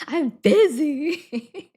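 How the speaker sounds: high, loud, drawn-out mock protest; hammed-up excuse. Joking excuse to mom for hanging up, hammed-up protest. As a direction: stretch it out high and loud, playfully.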